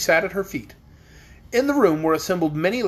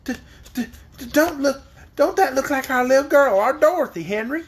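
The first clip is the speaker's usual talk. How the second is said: stammering frightened aunt; 8 semitones higher, 3 dB louder than usual. A narrator's reading.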